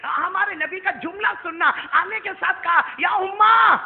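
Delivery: high, loud preaching that climbs to a shout; impassioned sermon delivery; full-force preaching. Acted. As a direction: preach it out to the crowd, rising to a cry. Preacher building the story.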